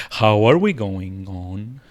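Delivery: slow, drawn-out attempt at posh. Shown as a demonstration. Streamer playfully greeting Posh Hamster, attempted posh voice. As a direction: put on a posh accent, taking it slowly. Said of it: "this is my attempt at a posh voice".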